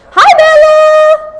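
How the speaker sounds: very high, loud sing-song hello to the dog; high puppy greeting. Real, not acted.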